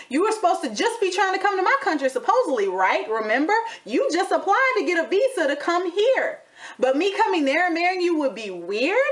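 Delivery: high, sassy scolding of an absent man; indignant rant. Real, not acted. Ranting at Azan about calling the wedding weird, high and sassy. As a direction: call him out, incredulous and mocking.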